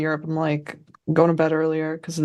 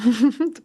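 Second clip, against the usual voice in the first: high giggle; 6 semitones higher than usual. Real, not acted. Laughs at the sarcastic comment.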